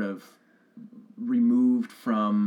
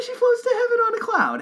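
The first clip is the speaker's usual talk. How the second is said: very high, airy and sing-song; dreamy floating voice; 6 dB louder than usual. Shown as a demonstration. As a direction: float it up like an angel. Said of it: "I have to use that tone of voice".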